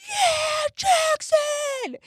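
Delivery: proud parent yelling across a prep school hall; screaming cheer. Retold or imitated. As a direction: yell his name from the crowd like a proud mom. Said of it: "And I scream".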